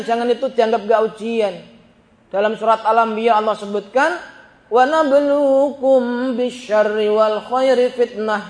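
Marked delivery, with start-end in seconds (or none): 4.7-8.5 slow, drawn-out verse recitation; Quran recitation. Real, not acted. Preacher quotes scripture to congregation, slow recitation. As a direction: recite the verse slowly, stretching the syllables.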